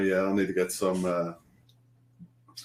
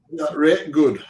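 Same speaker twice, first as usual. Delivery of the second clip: put-on higher-pitched, playful Sheffield accent; mock Sheffield accent; 6 semitones higher, 5 dB louder, slower than usual. Co-host teasing the Sheffield host, mock Sheffield accent. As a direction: put on a broad Sheffield "rate good". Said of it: "doing a Sheffield accent".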